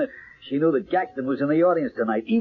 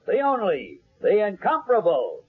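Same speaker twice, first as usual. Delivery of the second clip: showman's introduction; 5 semitones higher than usual. Acted. Announcer presenting the psychic to the audience, grand and booming. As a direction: build the crowd up for the star act.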